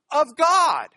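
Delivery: "of God" high and loud; stress on GOD. Shown as a demonstration. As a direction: punch "God" with a strong fall. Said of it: "The emphasis there is of God".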